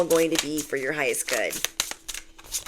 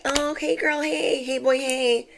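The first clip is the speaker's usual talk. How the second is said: higher, sugary mock hello; fake two-faced greeting; 9 semitones higher than usual. Mocking two-faced friends who greet you, fake-sweet and higher. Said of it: "toxic ashtrays that came in like".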